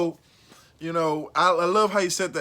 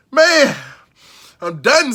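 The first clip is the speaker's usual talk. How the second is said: ordinary talk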